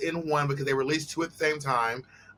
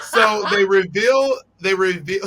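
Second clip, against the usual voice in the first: dramatic reveal; 4 semitones higher, 6 dB louder, slower than usual. Real, not acted. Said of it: "dramatic build-up".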